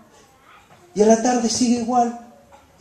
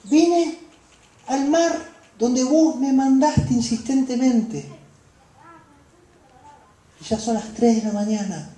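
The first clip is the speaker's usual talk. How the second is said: disciple protesting; 5 semitones higher than usual. Acted. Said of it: "dirían los".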